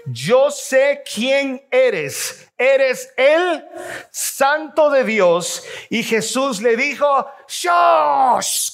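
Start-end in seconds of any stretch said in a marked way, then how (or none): 0.0-5.6 slow, drawn-out, every word weighed; slow group reading of the demon's words; slow reading aloud together. Shown as a demonstration. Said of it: "lea conmigo". 7.5-8.7 stern rebuke. Acted. Jesus rebukes the demon.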